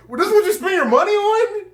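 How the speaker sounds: very high, laughing disbelief; squeaky mock-outraged reaction; incredulous outburst. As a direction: blurt it out high-pitched, cracking up. Retelling his incredulous reaction.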